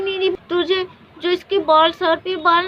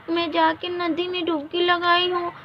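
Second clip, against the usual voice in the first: usual speaking voice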